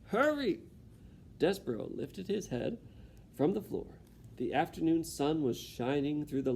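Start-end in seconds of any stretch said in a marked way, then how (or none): 0.1-0.6 high, sharp yell; Cook shouting. Acted. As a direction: yell it once. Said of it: "shouted Cook".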